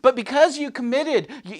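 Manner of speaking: normal talking voice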